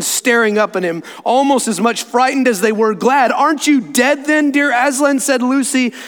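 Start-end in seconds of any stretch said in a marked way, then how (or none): 3.3-5.2 high-pitched, wondering question; amazed child. Acted. Lucy to Aslan, frightened and glad, amazed. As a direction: ask it in lightly acted disbelief.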